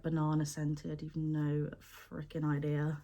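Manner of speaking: ordinary talk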